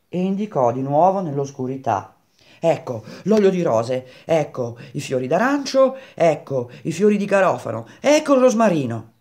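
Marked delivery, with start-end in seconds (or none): 2.6-9.1 excited list, building higher and louder; croaking Grenouille, excited. Acted. Grenouille pointing out scents in the dark, excited. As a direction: reel them off with mounting eagerness.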